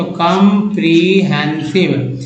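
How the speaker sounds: Comprehensive slowly, in four separate parts; teacher's slow, part-by-part comprehensive; split into parts. Shown as a demonstration. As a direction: sound out comprehensive as com-pre-hen-sive. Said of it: "चार part से बना है".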